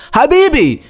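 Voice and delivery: high, loud, slow insistence; emphatic verdict. Real, not acted. Telling the viewer her partner cheated, slow and loud. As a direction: drag it out with certainty, loud and high.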